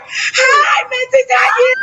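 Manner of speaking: high, cry-shout greeting; excited squeal. Real, not acted. Overjoyed host greets her friend's husband with squeals. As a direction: squeal with joy at a friend joining.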